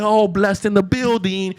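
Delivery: loud, high, slow DJ hype call; mock DJ announcement. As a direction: announce the star like a club DJ. Acts DJ announcing the singer.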